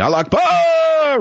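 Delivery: high, long drawn-out mock scream; imitated scream. Host mimics a Somali man on a plane, screaming. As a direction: stretch the last syllable out in a high cry. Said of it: "He was screaming".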